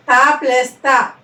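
ordinary talk

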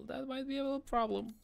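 quick, very high-pitched falsetto; tiny high voice admitting trouble; nervous squeak. Real, not acted. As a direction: squeak it out in a hurry. Realizing trouble, jokey squeak.

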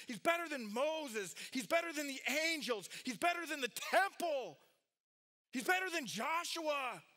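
high-pitched, driving list, hammering each name; emphatic proclamation. Acted. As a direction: proclaim each comparison like a rallying cry. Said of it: "this letter is".

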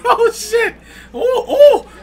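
high, loud, laughing oh shit; laughing yell. Real, not acted. Reacting to a sudden scrap, laughing and yelling. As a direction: cackle and shout at the wild exchange.

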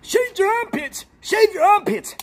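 high mocking squeal of a chat taunt. Streamer mimicking chat telling him to shave, high and mocking. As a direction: parrot the chat in a high whiny voice.

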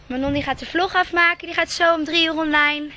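normal talking voice

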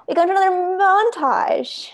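big, loud, high-pitched announcer flourish; showy announcement. Acted. Narrator announces another montage to the listeners, showy. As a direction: belt it out with theatrical relish.

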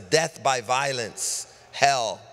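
ordinary talk

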